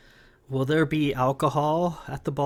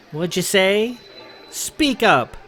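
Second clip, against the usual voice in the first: sharp, loud and higher, demanding; 7 semitones higher, 6 dB louder, slower than usual. Acted. Sarah bullies Sam at dinner.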